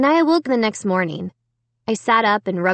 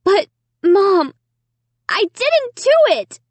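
very high, shrill protest; shrill insisting; 12 semitones higher, 5 dB louder than usual. A narrator's reading. Accused girl protesting to Mom, shrill insistence. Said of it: "I insisted shrilly".

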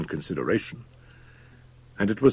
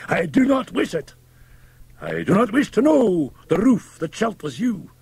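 high, loud-edged, ringing refusal; vehement interruption; 14 semitones higher, 4 dB louder than usual. A narrator's reading. Fettes cutting off the doctor, vehement refusal. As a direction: cut in fiercely, pitched very high. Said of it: "interrupted the other".

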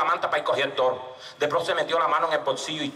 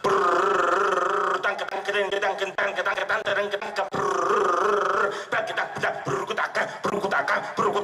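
rolled trills and rattling syllables; snare drum imitation; slower than usual. Teller plays snare on the bull's head, vocal drumming. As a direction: drum it out with the tongue. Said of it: "empiezo a tocar redoblante".

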